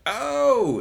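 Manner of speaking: sudden high, loud "oh"; acted surprise. Stripper offers a FaceTime and he reacts, acted surprise.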